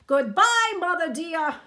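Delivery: high, loud farewell call. A narrator's reading. Hector bidding the crocodile farewell, calling. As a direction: call the goodbye across the water. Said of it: "Hector called out".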